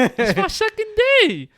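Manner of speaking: high, slow and a bit sheepish; new guy explaining himself to a coworker, high and sheepish. Retold or imitated. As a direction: sound small and apologetic, drawn out.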